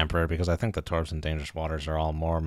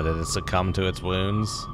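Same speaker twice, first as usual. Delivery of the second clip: normal talking voice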